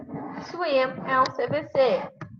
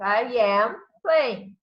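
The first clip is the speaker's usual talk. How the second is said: each English example said very slowly and clearly; slow, clear classroom demo; slow example sentences; much slower than usual. Shown as a demonstration. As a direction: spell out the sentence slowly for students. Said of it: "verbo principal e o complemento".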